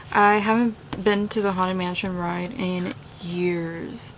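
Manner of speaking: ordinary talk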